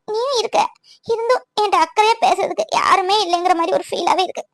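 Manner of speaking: ordinary delivery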